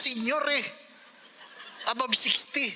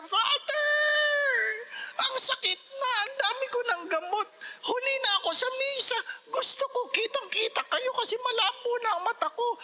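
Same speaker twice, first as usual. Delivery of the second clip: put-on complaining old parishioner; 12 semitones higher than usual. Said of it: "Sabi nga ng isang matanda".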